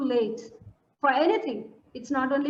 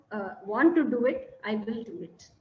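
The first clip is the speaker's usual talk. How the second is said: ordinary talk, nothing marked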